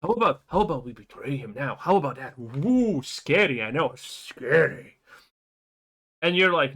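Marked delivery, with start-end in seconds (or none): 0.0-5.0 low, fast taunting mock voice; hammed-up sneaky traitor. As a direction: tease like a cartoon villain. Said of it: "the dude again comes to you and says".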